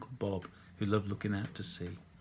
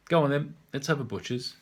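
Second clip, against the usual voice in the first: louder London cheer; Londoner; 4 semitones higher, 5 dB louder than usual. Acted. Londoner asking to see the painting, louder and eager. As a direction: demand a look like a pub mate.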